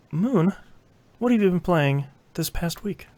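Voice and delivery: usual speaking voice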